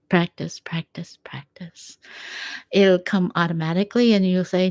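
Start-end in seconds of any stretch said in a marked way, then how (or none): 0.1-2.0 whispered, dying away to nothing; fading whisper. Shown as a demonstration. Urging viewers to practice, whisper fading out. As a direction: let each practice fade to a breath. Repeating the word for emphasis.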